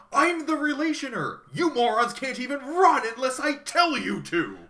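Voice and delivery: over-the-top villain outburst, pitched high; calling out. Retold or imitated. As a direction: declare it like a hammed-up tyrant. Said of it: "he calls out".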